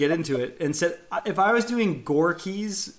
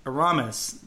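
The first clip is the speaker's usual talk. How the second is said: "Aramis" said uh-RAH-mis; "Aramis" with a full "ah" in the middle; stress on RA. Shown as a demonstration. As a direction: stress the second syllable of "Aramis". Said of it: "putting the stress on the Ra".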